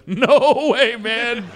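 high, loud, incredulous "no way"; acted refusal. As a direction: balk loudly at the crazy plan.

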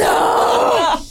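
high surprised shout; shocked oh. Real, not acted. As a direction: gasp out a surprised oh. Shocked reaction.

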